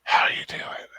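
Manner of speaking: breathy, voiceless small talk; whisper. Shown as a demonstration. Modelling for co-host, whisper. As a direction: ask it entirely on breath. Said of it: "You gotta whisper".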